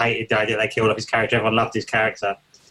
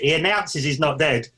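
usual speaking voice

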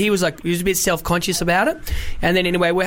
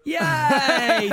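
higher mock cheer; 8 semitones higher than usual. Host cheering the guest's promised present, higher mock cheer. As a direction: cheer like a kid promised a treat.

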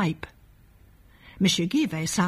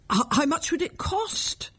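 higher, halting question after a sigh; hesitant, worried father; 9 semitones higher than usual. A narrator's reading. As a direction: ask reluctantly, stumbling on the first word. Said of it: "Papa rubbed his hands together miserably".

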